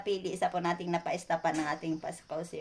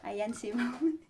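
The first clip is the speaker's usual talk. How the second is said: ordinary talk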